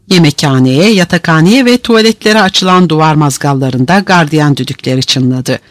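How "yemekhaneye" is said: ordinary delivery, nothing marked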